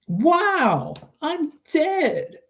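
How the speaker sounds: very high, dazed wow; the penny drops, he's dead; stunned realisation. Retold or imitated. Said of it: "his final moments were like".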